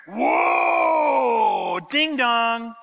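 mock shout. Acting out arriving chariot.

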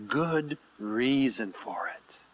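slow, soft near-whisper; hushed reassurance. Real, not acted. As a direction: let it sink in gently, almost whispered. Reassuring listeners about the shepherd.

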